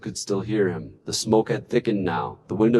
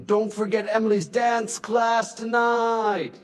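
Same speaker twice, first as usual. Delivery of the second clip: higher, slow, lightly voiced wife; wife's reminder; 10 semitones higher, slower than usual. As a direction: gently remind him as his wife.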